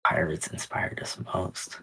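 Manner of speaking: murmured under the breath; whispering. Real, not acted. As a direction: whisper it to yourself, musing. Repeating the question to self.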